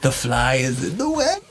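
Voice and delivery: slow laughing villain line; mock villain. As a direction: savour the trap slowly.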